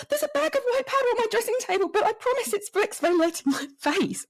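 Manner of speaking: high, rushed mock-panicky blurt; hurried, high-pitched retold protest; panicked explanation. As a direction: act out blurting an awkward excuse to police. Retelling a panicked explanation to police.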